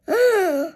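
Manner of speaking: loud surprised gasp; group gasps at the plan; sharp gasp. Acted. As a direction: gasp in shock. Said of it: "project gasped".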